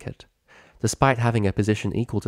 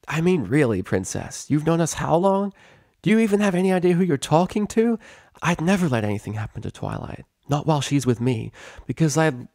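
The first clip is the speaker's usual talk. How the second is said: a hint of cocky pegasus; 7 semitones higher than usual. Rainbow defending herself.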